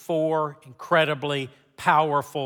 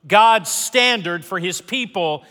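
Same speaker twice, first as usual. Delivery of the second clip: raised, rhythmic sermon delivery; preaching over music; 5 semitones higher, 6 dB louder than usual. Acted.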